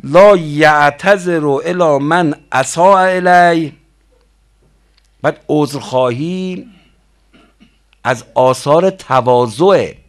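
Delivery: loud, slow intoning of a hadith; slow solemn recitation. Acted. Preacher intoning a hadith to the mosque crowd, slow and loud. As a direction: intone each word slowly to the congregation.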